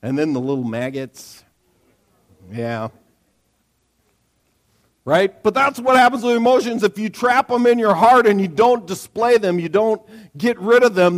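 usual speaking voice

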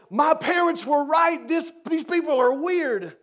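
high, lightly voiced scared onlooker; high mock quote of spooked onlookers. Onlookers spooked by the cemetery, voiced high. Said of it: "So they're going".

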